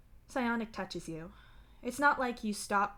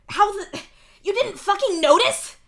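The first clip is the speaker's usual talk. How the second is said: high, loud, incredulous yell; angry shout; 13 semitones higher, 16 dB louder than usual. A narrator's reading. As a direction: shout it in outraged disbelief. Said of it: "he shouts".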